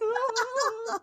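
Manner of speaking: high-pitched squeal, half laughing; acted squeamish cry; licked-face squeal. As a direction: squeal as a giant tongue licks you. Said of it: "He's like".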